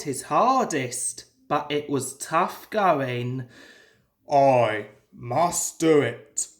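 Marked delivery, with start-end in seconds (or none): 4.3-6.5 low, slow, effortful chant; low, slow self-urging of a straining engine; Gordon urging himself. A narrator's reading. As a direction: grind it out step by step up the hill. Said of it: "he told himself".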